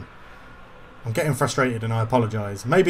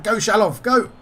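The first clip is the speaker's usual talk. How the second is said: higher, louder cheer-on; urgent urging; 7 semitones higher, 5 dB louder than usual. Real, not acted. Gamer urging Shalov into the attack, excited. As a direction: urge the player forward sharply.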